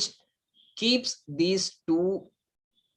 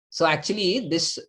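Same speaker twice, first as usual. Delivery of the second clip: ordinary delivery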